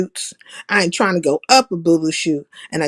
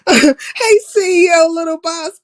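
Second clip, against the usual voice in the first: excited shout-out; 8 semitones higher, 4 dB louder than usual. Real, not acted. Greeting a viewer in chat.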